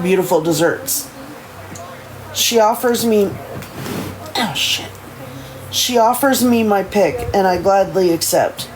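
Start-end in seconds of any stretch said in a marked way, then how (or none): none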